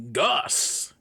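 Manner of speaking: ordinary talk, nothing marked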